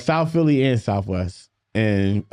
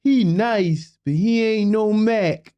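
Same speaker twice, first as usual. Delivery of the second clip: higher voice, slow heckle; imitating News; 10 semitones higher, slower than usual. News heckles from the back of the schoolyard, higher and slower. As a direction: call it out from the back of the crowd.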